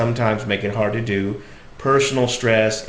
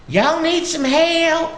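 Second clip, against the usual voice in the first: put-on very high, slow old-lady Southern drawl; much slower than usual. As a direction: call it out high and drawn-out like a country granny. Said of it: "I was using that dialect".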